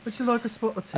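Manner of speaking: put-on British accent. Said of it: "supposed to be Irish".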